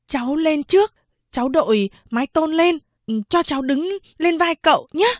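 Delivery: put-on high, earnest child voice rising; a boy coaxing his young master to escape; eager boy servant. Servant boy urging escape, high and eager.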